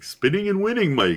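high-pitched mimicked broadcaster catchphrase; Clyde impersonation. Broadcaster tossing a catchphrase to Mike, playful impersonation. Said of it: "Clyde's a hard impersonation to do".

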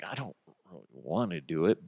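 soft, low reluctant mumble; grudging and unenthusiastic; can't-be-bothered delivery; half-assed reluctance. Acted. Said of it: "half-ass".

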